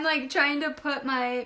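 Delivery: ordinary talk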